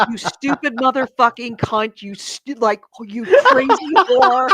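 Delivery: mock angry yell at the TV; retold yelling. Gamer retelling cursing at the TV, mock yell. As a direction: replay the screaming at the television. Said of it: "you're yelling like".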